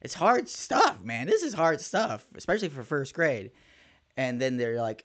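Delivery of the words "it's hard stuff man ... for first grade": emphatic exclamation. Acted. Stressing how hard first grade math is.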